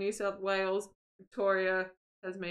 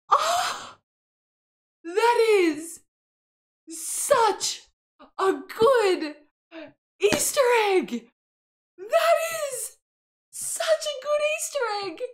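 high, loud, word-by-word gushing; thrilled squealing; 11 semitones higher, 6 dB louder, slower than usual. Real, not acted. Gamer raving about such a good Easter egg, high thrilled squeal. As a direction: squeal each word apart in sheer delight.

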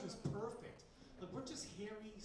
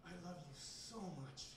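loving movie line; 2 semitones lower, 10 dB quieter than usual. Retold or imitated. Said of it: "she looks at him lovingly and says".

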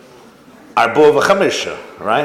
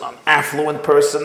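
ordinary delivery

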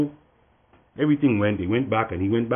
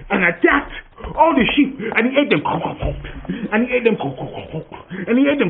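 higher, louder storytelling climax; dramatic build; 11 semitones higher, 3 dB louder than usual. A narrator's reading. Narrator telling children how the wolf ate the sheep, dramatic. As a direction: build the horror as the wolf feeds.

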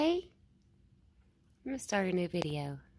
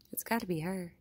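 normal talking voice

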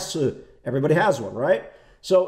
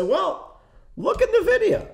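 mock squeaky investor; 14 semitones higher, slower than usual. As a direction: voice jumps way higher, mocking the cheerful investor. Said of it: "you turn around and say".

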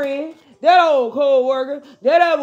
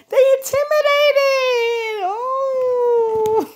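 high, wailing fake cry; hammed-up sob; mock crying; 10 semitones higher, much slower than usual. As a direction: pretend to sob for the haters. Mocking the haters, crying voice.

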